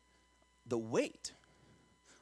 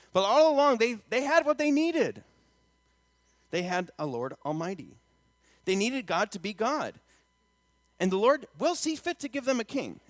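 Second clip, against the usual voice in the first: high, loud preaching that eases between points; impassioned preaching; 7 semitones higher, 7 dB louder than usual. Acted. Preacher to congregation about Israel wanting a king, impassioned preaching. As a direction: drive the big points home from the pulpit.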